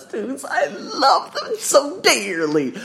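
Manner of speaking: high, slow, half laughing half crying; real emotion breaking through laughter; laughing tearfully. Real, not acted. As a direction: gush through laughter and tears. Gushing about beloved students.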